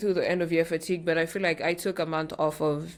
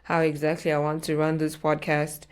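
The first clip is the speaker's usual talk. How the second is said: normal talking voice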